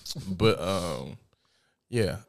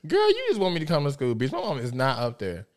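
dismissive disbelief; 6 semitones higher than usual. Retold or imitated. Retelling disbelief at friend's call.

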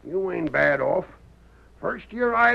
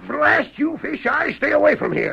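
angry; 6 semitones higher, 3 dB louder than usual. Acted. Sheriff angry at Fish Eye.

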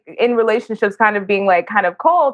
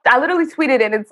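normal talking voice

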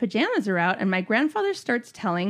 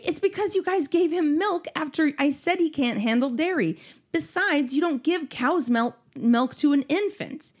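higher-pitched retold exasperation; snapping; 5 semitones higher than usual. Fed-up mother to grandmother about milk, retold snap. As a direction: finally let the pent-up complaint out, mildly acted. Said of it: "I finally snap and say".

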